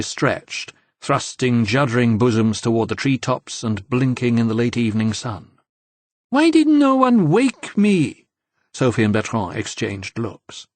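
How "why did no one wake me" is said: much higher, louder and slow drawn-out complaint; a woman just woken, miffed nobody roused her; hammed-up high whine of a sleepy girl; sleepy young woman, high and petulant. A narrator's reading. Sleepy Nicole emerges from van.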